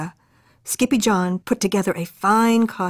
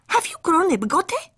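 tiny dog voice; 10 semitones higher than usual. Acted.

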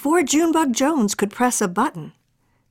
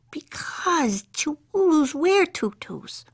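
little sister voice; 7 semitones higher, slower than usual. Acted.

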